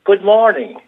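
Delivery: loud, high good morning to the whole queue; greeting a silent bank queue, loud and high; hearty greeting. Retold or imitated. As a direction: call out a big hello to everyone. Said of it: "he turned round and he said".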